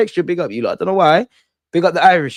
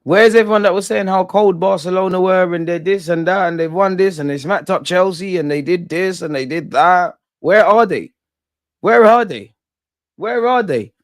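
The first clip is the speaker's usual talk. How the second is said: high, raised-voice challenge to the doubters; triumphant taunting; 6 semitones higher than usual. Real, not acted. Fan calling out everyone who hyped Barcelona, triumphant taunting. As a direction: call them out, pressing harder on the repeated question.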